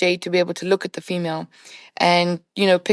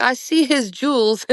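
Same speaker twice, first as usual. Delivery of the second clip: imitating Jay; 5 semitones higher than usual. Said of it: "he's like".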